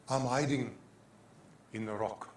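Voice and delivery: low, soft, measured answer; hushed, settled reply falling away; quiet assurance. Acted. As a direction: answer the challenge quietly, with pauses. Answers the challenge just voiced.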